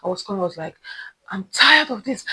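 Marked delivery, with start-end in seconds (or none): 1.3-2.2 raised, higher fed-up complaint; retold rant. Ranting to boyfriend on the phone, mildly worked up. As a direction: vent to someone close, exasperated. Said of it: "I just ranted".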